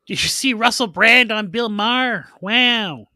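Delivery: excitable mock messages from a friend; imitating absent friend. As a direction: put on a high voice, pitching hot gossip. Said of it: "he would have been messaging".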